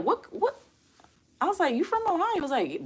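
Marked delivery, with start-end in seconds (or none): 0.0-0.6 high-pitched mock bafflement; baffled disbelief. Streamer retells culture shock on reaching Ohio, baffled disbelief. 1.7-2.4 very high-pitched mock disbelief; incredulous question. Streamer retells questioning Ohio locals, incredulous.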